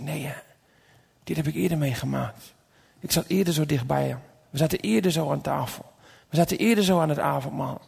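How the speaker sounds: soft, subdued muttering to oneself; quiet inner thought. Acted. Peter remembers earlier moments with Jesus, quiet and subdued. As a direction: think it aloud, keeping the volume down.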